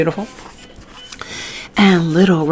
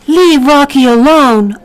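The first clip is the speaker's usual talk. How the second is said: high, louder little fairy voice; wee fairy voice; 7 semitones higher, 4 dB louder than usual. A narrator's reading. Little fairy defends Rocky in a high wee voice. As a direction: pipe up like a tiny fairy. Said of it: "a wee voice said".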